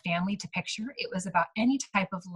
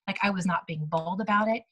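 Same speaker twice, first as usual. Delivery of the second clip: ordinary talk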